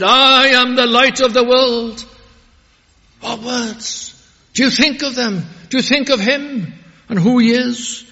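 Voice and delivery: loud, high, ringing sermon voice; full-force pulpit preaching; fiery preaching. Acted. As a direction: proclaim it to the whole congregation.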